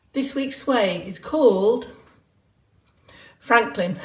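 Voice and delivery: long suspenseful pause before "Franklin"; pause for effect. Shown as a demonstration. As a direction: hold back after "called", then reveal "Franklin". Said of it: "Pause for effect".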